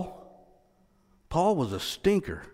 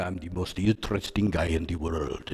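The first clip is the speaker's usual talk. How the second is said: a light imitation of the Dos Equis ad man's accent, lower; Dos Equis guy accent; 10 semitones lower, 6 dB quieter than usual. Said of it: "in his wonderful accent".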